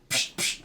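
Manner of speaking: two soft, low psh puffs; imitated pump hiss. Shown as a demonstration. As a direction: mimic a shoe pump with short puffs. Imitates pumping up Reebok shoes.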